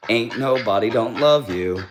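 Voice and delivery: ordinary talk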